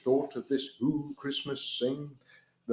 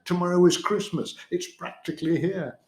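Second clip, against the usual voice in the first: high, fast sneer; lightly voiced grumpy Grinch; 7 semitones higher, faster than usual. A narrator's reading. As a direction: spit it out with disgust. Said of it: "he snarled with a sneer".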